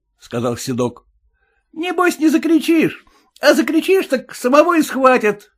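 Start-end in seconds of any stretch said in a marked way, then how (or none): 1.7-5.5 much higher, louder wary coachman; high suspicious cabbie; cab driver voice. Acted. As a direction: play the sly cabbie, pitched well up.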